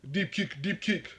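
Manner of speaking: badly faked African accent; put-on African accent. Shown as a demonstration. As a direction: mimic an American trying an African accent. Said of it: "try to talk like I'm from Africa".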